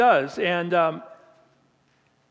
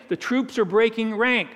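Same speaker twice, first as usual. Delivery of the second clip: usual speaking voice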